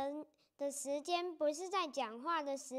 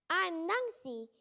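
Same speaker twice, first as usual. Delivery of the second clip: speaking slowly. Acted. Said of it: "I speak slowly so he will understand".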